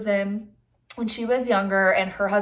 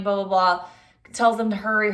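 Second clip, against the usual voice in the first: usual speaking voice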